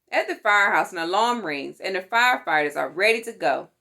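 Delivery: ordinary delivery, nothing marked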